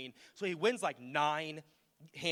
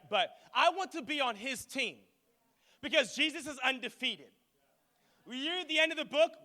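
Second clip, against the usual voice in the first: raised-pitch, driving sermon delivery; impassioned preaching; 8 semitones higher, 4 dB louder than usual. Real, not acted. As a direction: preach it like a rallying team talk. Preaches on Jesus undefeated.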